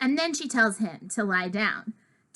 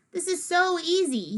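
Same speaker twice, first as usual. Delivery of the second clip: higher, mocking singsong of a know-it-all; mocking imitation; 8 semitones higher than usual. Imitating a classmate's smug catchphrase, mockingly. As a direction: mimic his smug catchphrase, pitched up. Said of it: "I hate when he says that".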